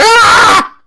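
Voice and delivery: high, drawn-out scream; acted scream. Player's character splayed by tentacles, high scream. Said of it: "He just screams out like".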